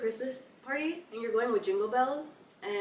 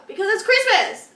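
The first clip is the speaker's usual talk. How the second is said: excited outburst; 10 semitones higher, 8 dB louder than usual. Real, not acted.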